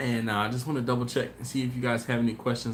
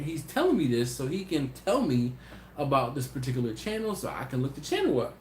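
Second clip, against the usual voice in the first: ordinary talk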